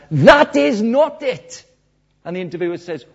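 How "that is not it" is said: high, loud, slow declaration; emphatic refusal. Retold or imitated. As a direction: spell out the refusal word by weighty word. Retold defiant answer to himself.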